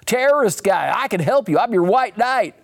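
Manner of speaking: oversell yourself as the helpful guy; stretched-out glad-handing schmoozer, easing into patter; Harry Ellis. Retold or imitated. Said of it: "the guy was like".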